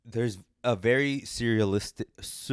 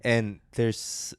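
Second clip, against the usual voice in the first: normal talking voice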